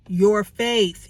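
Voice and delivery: usual speaking voice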